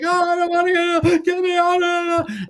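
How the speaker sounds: very high, loud panicked mock scream; Lou screaming. Lou thinks he's on the train tracks, screaming in high-pitched panic. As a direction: shriek it like a comic in terror. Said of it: "and he's screaming".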